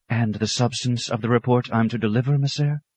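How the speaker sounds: a worried aide protesting; anxious clerk's voice. Acted. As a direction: clerk protesting to his superior. Clerk Claret speaking.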